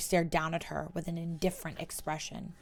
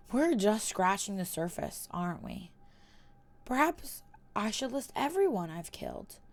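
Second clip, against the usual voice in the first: softer, taunting; quiet menace; 2 semitones higher, 6 dB quieter than usual. Acted. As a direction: lean in cruelly. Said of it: "with a malicious smile".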